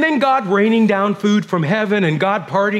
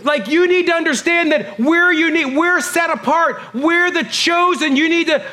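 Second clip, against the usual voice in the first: much higher, whiny imitation of a proud listener; whiny mock protest; 9 semitones higher than usual. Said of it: "in case there".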